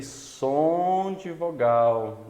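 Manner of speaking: som stretched long, then de vogal; stress on som. Shown as a demonstration. Teacher flags what matters, som heavily drawn out. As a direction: lean hard and long on som. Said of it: "Isso que é importante".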